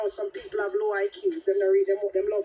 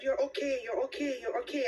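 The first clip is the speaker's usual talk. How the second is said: calming reassurance; 4 dB quieter than usual. Retold or imitated. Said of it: "I'm trying to calm him down".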